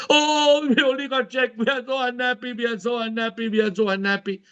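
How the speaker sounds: high hammed-up moaning; family moaning about the uncashed check, pitched high; mock lament. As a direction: wail like the family over the check.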